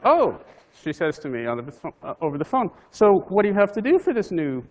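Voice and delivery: a mock eager wife, pitch jumped up; wife on the phone. Wife teasing her husband about his new job, high and loud.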